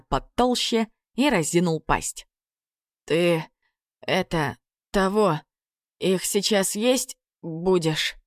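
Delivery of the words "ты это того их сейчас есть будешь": halting, hesitant question; stammering. A narrator's reading. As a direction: stumble over the words in shock. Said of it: "запинаясь".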